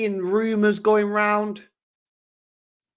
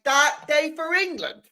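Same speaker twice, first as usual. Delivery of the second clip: higher, mocking disbelief; incredulous echo; 8 semitones higher than usual. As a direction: toss the phrase back as if it's absurd. Throwing the headline back in disbelief.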